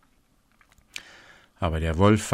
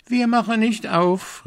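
put-on high, loud kids' voice, much higher; goat kids calling; 5 dB louder than usual. A narrator's reading. Little goats refusing the wolf, high and loud. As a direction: shout it like defiant little kids. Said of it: "riefen sie".

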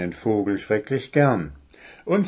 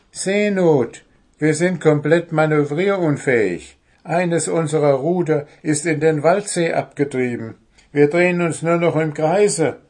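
raised, urgent higher voice; desperate shout; 6 semitones higher, 5 dB louder than usual. A narrator's reading. Goblin explains the crisis to sleepy owl, desperate shout. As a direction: cry out the emergency, pitched up and louder. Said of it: "schrie Wuschel verzweifelt".

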